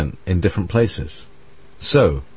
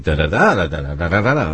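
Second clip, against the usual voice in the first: quick da-da-da mimicking own speech rhythm; own accent's rhythm; 3 semitones lower, faster than usual. Shown as a demonstration. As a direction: beat out your speech rhythm in da-das. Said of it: "when I speak".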